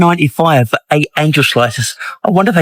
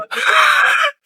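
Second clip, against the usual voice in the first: shrieking laugh; 3 dB quieter than usual. Real, not acted. Shocked at supermarket prices.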